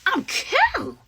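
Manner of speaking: high, sing-song I'm cute; cutesy brag. Real, not acted. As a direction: preen about yourself playfully. Bragging happily about new romance.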